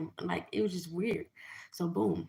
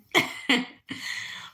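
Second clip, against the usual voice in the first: loud high laugh; 8 semitones higher, 6 dB louder than usual. Real, not acted. Amused telling friends how husband was right, big laugh. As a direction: burst of high cackling laughter.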